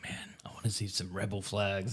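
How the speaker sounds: soft unvoiced whisper. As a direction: whisper it conspiratorially. Retold wish in whisper.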